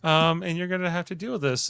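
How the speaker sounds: imitating a doctor's reply; calm coping. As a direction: answer as if explaining to a child. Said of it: "She's like".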